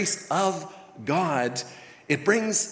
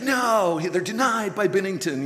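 excited mock commentator; 4 semitones higher, 3 dB louder than usual. Commentators calling saves.

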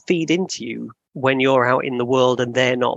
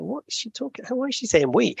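baffled inner voice; 10 semitones higher, 4 dB quieter, faster than usual. Retold or imitated. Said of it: "And I'm like".

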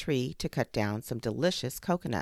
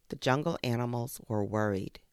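usual speaking voice

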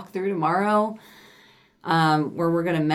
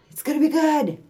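much higher, cheerier repeat; upbeat repeat; 11 semitones higher, 4 dB louder than usual. Shown as a demonstration. Reassuring cohost about the move, a high upbeat repeat. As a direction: lift it high and bright the second time. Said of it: "repeated reassurance".

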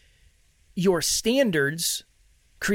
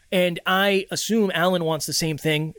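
normal talking voice

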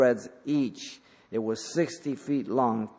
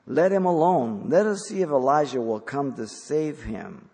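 mocking bystander; 4 semitones higher, 6 dB louder than usual. Mocking spectators at the cross.

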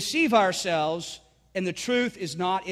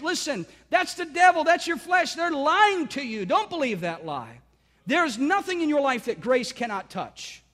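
full-force preaching; 7 semitones higher than usual. Acted. Pastor urging the church not to believe the lie, fervent preaching. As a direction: preach it out over the congregation.

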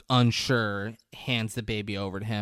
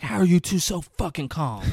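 mildly exasperated mock outburst; retold angry outburst; 5 semitones higher than usual. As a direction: re-enact anger with raised pitch. The same outburst, retold more lightly.

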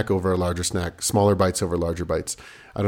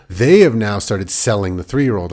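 ordinary talk, nothing marked

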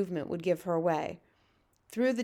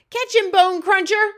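full, very high giant yell; shouting; 11 semitones higher, 12 dB louder than usual. Acted. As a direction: yell far across to a teammate.

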